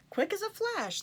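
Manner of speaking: plain reading voice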